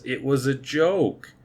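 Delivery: hollow, unconvincing just-kidding voice. Retold or imitated. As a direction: imitate a badly delivered excuse, drawn out. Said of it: "she very unconvincingly says".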